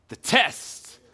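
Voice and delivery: "test" set apart and weighted; stress on test. Real, not acted. Preacher to church, stressing "test" in the verse. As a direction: lean hard on "test", a touch apart. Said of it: "I wanted to emphasize that word test".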